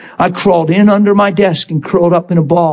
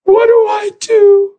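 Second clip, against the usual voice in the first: much higher, slow, helpless plea; imitating desperate plea. Broken man on the phone asking what to do, high slow plea. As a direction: ask it high and lost, as if begging. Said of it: "That's what they're saying".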